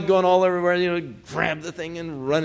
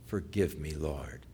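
low, soft, slow pleading prayer; penitent prayer; 7 semitones lower, 10 dB quieter, slower than usual. Acted. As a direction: pray humbly and quietly, pausing between petitions. Models a sinner's prayer.

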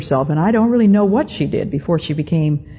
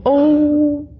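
high, drawn-out Southern "Oh", falling on the name; Southern accent; 10 semitones higher, much slower than usual. Retold or imitated. House mother greeting her student, imitated Southern accent. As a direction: imitate an older Southern belle, slow and plaintive. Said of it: "with that Southern accent".

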